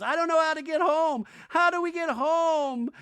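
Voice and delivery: loud, high-pitched imitation wail; hammed-up frantic voice; mock panic. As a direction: cry out as if lost, overdone. Imitates lost, panicking woman running.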